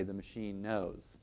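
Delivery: soft, slow, resigned; quiet resigned murmur. Retold or imitated. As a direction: murmur it under your breath, resigned. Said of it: "we have to say".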